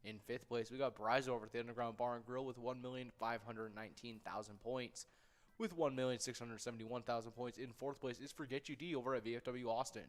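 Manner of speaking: ordinary talk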